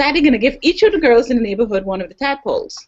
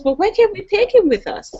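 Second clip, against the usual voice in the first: put-on pleading child; 9 semitones higher than usual. Daughter pleading.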